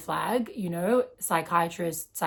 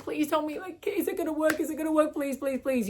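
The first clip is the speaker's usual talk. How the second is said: put-on high, pleading little-kid voice; childlike pleading; 9 semitones higher than usual. Young woman begging the healer, like a child, high and pleading. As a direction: beg like a small child who needs reassurance. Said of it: "I was kind of like a child".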